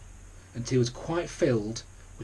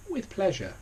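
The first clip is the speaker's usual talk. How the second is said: higher, genteel flamingo voice; gracious flamingo; 7 semitones higher than usual. A narrator's reading. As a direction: answer graciously. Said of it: "said the flamingo bird".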